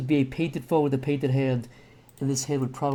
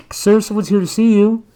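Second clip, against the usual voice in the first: loud, higher secretary voice over the intercom; intercom announcement; 7 semitones higher, 10 dB louder than usual. Acted. Secretary announcing a visitor to the CEO, loud and higher.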